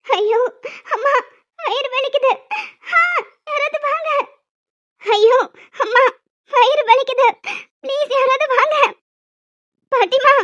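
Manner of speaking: high, loud put-on cries of pain; faked stomach pain. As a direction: ham up a bellyache to fool them. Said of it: "பெர்ஃபார்மன்ஸை start பண்ணிட வேண்டியதுதான்".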